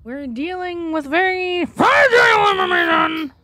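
high wail building to a shout; mock-dramatic outburst. Streamer warning viewers of fragile information, hammed-up shout. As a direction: build from hushed to a strained yell.

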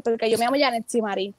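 usual speaking voice

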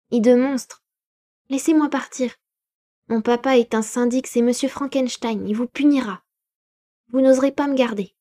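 put-on high, loud, desperate boy's voice; terrified boy shouting at the creature; frightened child. A narrator's reading. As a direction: scream it like a panicked child fighting free. Boy threatens with his father.

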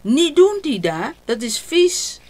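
high, loud startled warning; alarmed shout. A narrator's reading. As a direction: grandpa shouting to stop Dida eating foam. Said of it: "Opa schrikt". (geschrokken luide waarschuwing)

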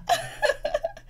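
high, helpless laugh. Real, not acted. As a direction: crack up at the memory. Cracking up at the retold row.

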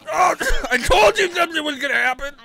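high gleeful yell through laughter; laughing shout. Real, not acted. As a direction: crow with laughter. Prediction comes true, laughing.

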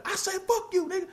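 high-pitched shout from a distance; imitated heckler yell. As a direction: mimic a heckler yelling from far off. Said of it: "people just yelling".

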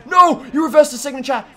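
high, loud, rapid-fire "no, no, no"; alarmed protest. Real, not acted. Player whose signature got reversed, alarmed protest.

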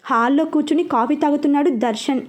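plain reading voice

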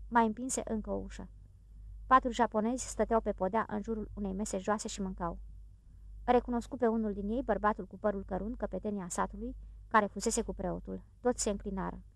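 plain reading voice

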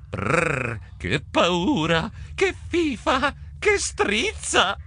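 shivering brr, then rising squeals of fright; frightened grumbling. A narrator's reading. Trappola goes down the dark stairs, muttering in fright. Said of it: "Trappola borbottò".

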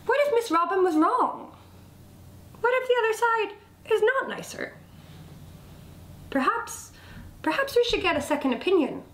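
high, quick worried wondering; doubting rock. A narrator's reading. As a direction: voice a rock having sudden doubts.

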